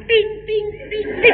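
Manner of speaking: high, light sing-song ting ting; imitated chime. Wife imitating makeup sound.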